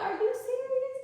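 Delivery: incredulous. Retold or imitated. Speaker chin-checking herself, high and incredulous. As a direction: scold yourself in disbelief. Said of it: "I'm like".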